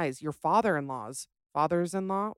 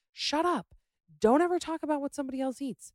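angry outburst; 8 semitones higher, faster than usual. Real, not acted. Rant at rude servers.

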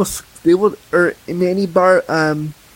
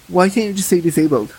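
normal talking voice

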